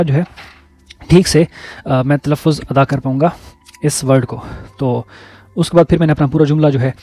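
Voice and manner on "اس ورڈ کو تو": ordinary delivery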